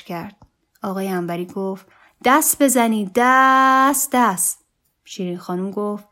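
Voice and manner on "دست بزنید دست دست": loud, high, stretched party chant; rally the room to clap; rousing call. A narrator's reading. Guest calling the party to clap, rousing shout.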